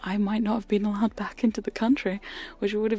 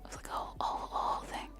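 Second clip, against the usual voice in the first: very soft close whisper; ASMR whisper; 10 dB quieter than usual. Acted. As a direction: whisper the story into the mic.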